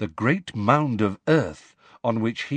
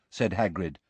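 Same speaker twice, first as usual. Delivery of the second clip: usual speaking voice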